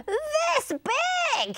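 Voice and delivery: screechy, very high parrot cry; high-pitched storybook exclamation. A narrator's reading. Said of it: "he cried".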